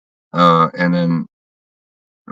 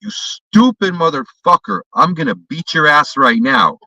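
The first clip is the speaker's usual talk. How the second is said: raised, slow angry threat; retold threat; 7 semitones higher than usual. Confronting the man who snatched the bottle, angry raised threat. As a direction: spit it at him like you mean to fight. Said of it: "and I go".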